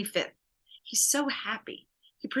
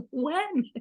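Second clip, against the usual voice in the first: incredulous; 8 semitones higher than usual. Retold or imitated. Incredulous retort to her hope.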